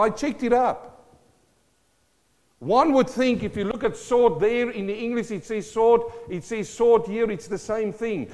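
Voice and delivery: high, loud teaching from the pulpit; excited preaching. Real, not acted. Pastor shows congregation how skillful the sword is, excited preaching.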